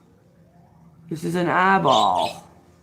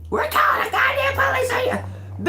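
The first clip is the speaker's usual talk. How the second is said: much higher, shouted mock of the neighbour; imitating the neighbour yelling a threat about the police; mocking shrill neighbour. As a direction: squawk her threat back in a shrill mocking voice. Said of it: "neighbour threatens to call police".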